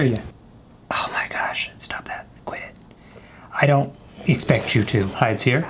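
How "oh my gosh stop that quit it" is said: hushed, breathless whisper while hiding; scared whisper. Real, not acted. Hiding from the monster in the game, whispering. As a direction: whisper so the monster can't hear.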